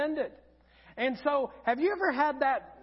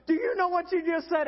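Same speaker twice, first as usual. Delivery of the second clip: higher-pitched retold disbelief; imitated incredulous wife; 6 semitones higher, 4 dB louder, faster than usual. Wife to husband after his sung slip, incredulous. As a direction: ask it in mock astonishment, pitched up.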